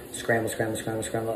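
soft, low hurried repetition; low frantic muttering to yourself; muttered scramble. Pretend. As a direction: mutter it under your breath like you're panicking. Acting out a money scramble.

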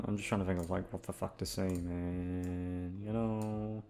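usual speaking voice

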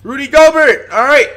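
loud, high, shocked shouts; real hype shouting at the screen; excited yelling. Real, not acted. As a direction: yell it out in disbelief. Streamer erupts at Gobert play.

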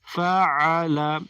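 "ফাআলা" very slow, middle "আ" squeezed in the throat; throat-pressed ayn. Shown as a demonstration. Teacher repeats the drill for learners, throat-pressed "আ", slowly stretched. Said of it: "গলার ভিতরে চাপ দিবেন".

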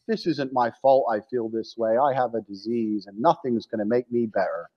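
low, soft, defeated mock whine; mock depressed whiner. Mocking the depressed person who blames a disease, low and soft. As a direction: sink low and quiet as if giving up.

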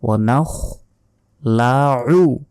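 slow নাখলাউ with throaty ayn; drawn-out নাখলাউ with scraped kh; careful ayn demonstration. Shown as a demonstration. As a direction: stretch নাখলাউ, lips rounded, ayn from the throat. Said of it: "আইনের উচ্চারণ করতে হবে".

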